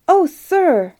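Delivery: high, loud appeal; crying out. A narrator's reading. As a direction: call out urgently to get his attention. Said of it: "cried the prince who was in charge".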